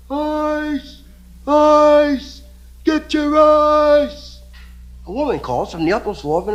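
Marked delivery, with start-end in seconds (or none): 0.1-4.4 high, loud, drawn-out vendor cry; street vendor yell. Acted. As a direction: call it down the street like an iceman. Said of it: "he's yelling".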